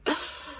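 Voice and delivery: imitated gasp of shock. Wife hearing the stranger's age, mock shocked gasp. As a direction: gasp as if stunned by the answer. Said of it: "she was shocked".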